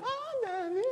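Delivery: put-on swooning fan girl. Fans swooning over the dancer, high and starstruck. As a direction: sigh his name like a starstruck fan.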